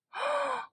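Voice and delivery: very high, sharp gasp; amazed gasp. Real, not acted. Fan leafing through a photo book, amazed gasp. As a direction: gasp at a stunning surprise.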